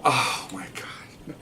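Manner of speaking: very slow, breathy whisper of disbelief; stunned whisper. Retold or imitated. Detectives reacting to the parrot's insult, stunned whisper. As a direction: exhale it slowly, barely voiced.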